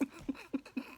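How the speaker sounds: high, helpless giggle; soft, squeaky run of laughter; laughing. Real, not acted. Cracks up at crude film moment.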